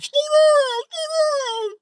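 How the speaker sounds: high, crying mock plea to slow down; whiny passengers. Passengers whining at the speeding driver, high mock cry. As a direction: mimic scared friends whining.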